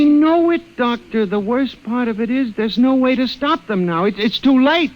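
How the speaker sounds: he can't make them see it; patient pleading with a calm doctor; frantic insistence. Acted.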